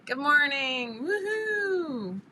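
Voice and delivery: high, drawn-out singsong cheer; excited greeting. Real, not acted. Host welcoming livestream viewers, excited singsong cheer. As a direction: sing out a loud hello, rising into a whoop.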